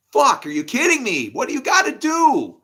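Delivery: loud, high-pitched incredulous rant; exasperated shouting. Acted. Speaker railing at people who won't listen, exasperated shout.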